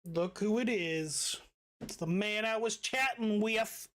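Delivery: normal talking voice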